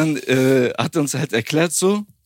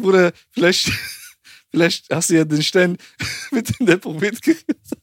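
laughing through quote; 4 semitones higher than usual. Retold or imitated.

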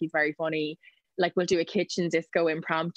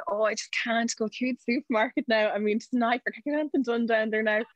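high, put-upon celebrity moan; mock weary mum; 5 semitones higher than usual. Mum pretending the supermarket is a nightmare, put-upon. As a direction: complain as if fame is a burden. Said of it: "she's like".

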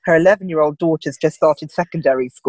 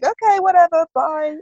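put-on breezy kid brush-off; 10 semitones higher than usual. Daughters shrug off the talk.

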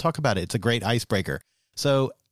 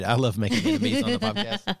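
laughing sarcasm; 10 semitones higher than usual. Real, not acted. Sarcastic joke about enemies.

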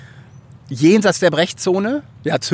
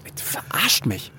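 slow hushed whisper; stunned whisper; 6 dB quieter, slower than usual. Retold or imitated. To the partner who quits, a slow whispered disbelief. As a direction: breathe it out, unable to believe it.

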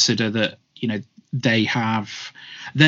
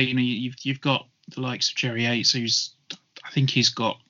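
ordinary delivery

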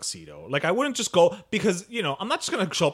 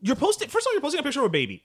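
outraged; 12 semitones higher, 4 dB louder, much faster than usual. Real, not acted. Outraged at nurse's posts.

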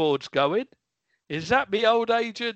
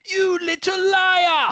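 high, loud, slow mock scolding; hammed-up indignant cry; mock outrage; 12 semitones higher, 7 dB louder, slower than usual. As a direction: pretend to be shocked at a fib. Santa scolds Adam jokingly.

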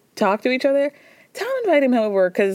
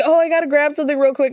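fast, higher mock excuse; Tom dodging again; 6 semitones higher, faster than usual. Host mimicking Tom escaping the conversation, quick and higher. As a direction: toss off a rushed fake excuse. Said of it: "Tom is like".

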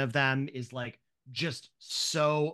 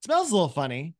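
put-on high, shrugging kid voice, a bit louder; happily brushing off the smell; cheerful child; 4 semitones higher, 5 dB louder than usual. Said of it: "She's like".

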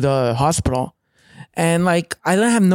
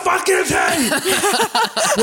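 high, slow yelled demand; hunting someone down in a rage, shouted; retold furious yell; 14 semitones higher, much slower than usual. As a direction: yell it up at the windows, slowed and stretched. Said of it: "and just yelling".

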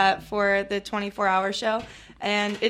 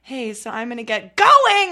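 put-on loud, slow line, as if an accent made her louder; suddenly loud; 10 dB louder than usual. Recreating her loud accent take in the recording session, loud and slow. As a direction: belt it out, slowly. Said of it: "I'm just like".

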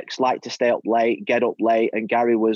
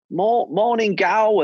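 Cockney greeting; 6 semitones higher, much slower than usual. Retold or imitated. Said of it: "all in these Cockney accents".

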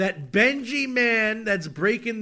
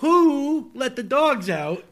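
slow and a bit louder; mock grand reading; 4 semitones higher, 5 dB louder than usual. Shown as a demonstration. Host declaims the chorus to the co-host, slow and grand.

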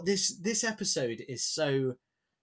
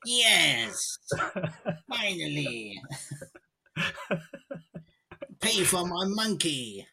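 higher, slow villain gloat; cartoon villain voice; 5 semitones higher, slower than usual. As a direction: ham it up like a cartoon baddie. Mocking villain scheme, laughing.

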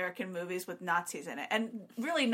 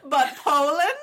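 much louder, higher scandalized cry; mock outrage; 7 semitones higher, 11 dB louder, slower than usual. Playing Britain shocked over Poland, loud high mock outrage. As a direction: gasp it out like an offended aristocrat.

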